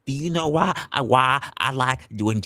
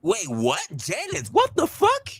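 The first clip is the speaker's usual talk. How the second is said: high, stunned outburst; shocked doctor. Retold or imitated. Doctor reacts again.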